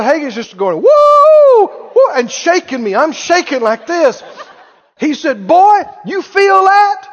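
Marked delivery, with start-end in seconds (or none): 0.8-2.1 high, loud drawn-out whoop; sudden high whooping; imitated holler. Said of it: "him hollering". 5.5-7.1 high, loud, drawn-out challenge; imitated excited preacher's shout; Brother Hagin hollering. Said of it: "him hollering".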